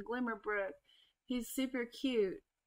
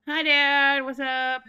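excited greeting; 4 semitones higher, 11 dB louder, slower than usual. Pretend. Greeting game character Dad loudly.

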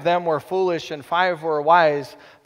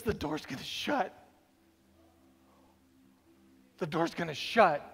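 hushed warning; 4 semitones lower, 10 dB quieter than usual. Acted. Warning softly.

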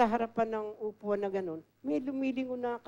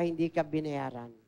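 usual speaking voice

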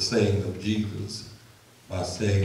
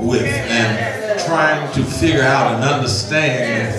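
steady, measured public prayer; earnest prayer; 5 semitones higher, 7 dB louder than usual. Acted. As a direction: pray aloud before the congregation, deliberate and sincere.

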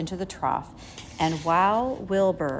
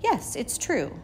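sighing; 7 semitones higher than usual. A narrator's reading. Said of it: "sighed Charlotte".

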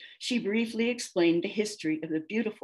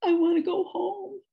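high-pitched tearful whine; mock-crying, pitched way up; theatrical wail; 12 semitones higher, 4 dB louder than usual. A narrator's reading. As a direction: wail it like a hammed-up, homesick crybaby. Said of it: "she wailed".

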